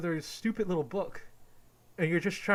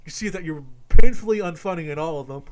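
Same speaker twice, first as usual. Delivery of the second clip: much louder, yelling it out; furious shout; 16 dB louder than usual. Real, not acted. Yelling at Doug that he's unfunny, furious shout. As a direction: blast the insult at full volume. Rant peak.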